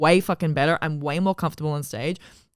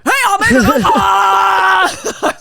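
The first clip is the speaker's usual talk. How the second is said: much higher, slow, loud mock yell; mock shout; 11 dB louder, much slower than usual. Mocking her old self to her friend, a slow loud high yell. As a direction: ham up your old wild self. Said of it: "I was like".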